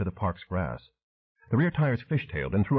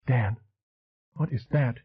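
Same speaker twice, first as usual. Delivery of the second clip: a tense, scared question, pitched up; tight, frightened question; 4 semitones higher than usual. A narrator's reading. To Dan, seeing the twitching shirt: tight, scared. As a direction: fear tightening her voice as she asks. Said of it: "Then her voice tight".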